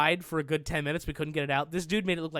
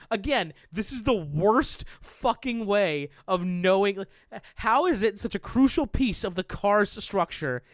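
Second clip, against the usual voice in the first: raised-pitch rant, heavily stressed; indignant; 4 semitones higher, 4 dB louder than usual. Retold or imitated. Car owner to sister about inspections, indignant. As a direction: protest it with punched key words.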